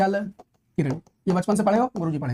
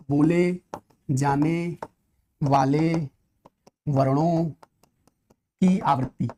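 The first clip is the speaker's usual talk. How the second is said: each word spoken alone with pauses, sinking lower; slow, deliberate definition for students to note; word-by-word dictation; 4 semitones lower, much slower than usual. Shown as a demonstration. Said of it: "तब उसको श्रुत्यानुप्रास बोलते हैं".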